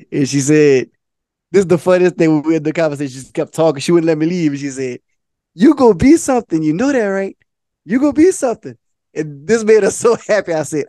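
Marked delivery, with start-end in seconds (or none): 5.5-8.8 high, punchy pep talk; raised pitch, insistent and encouraging; emphatic encouragement. Retold or imitated. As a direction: imitate an older lady hyping someone up. Retelling the lady's emphatic encouragement.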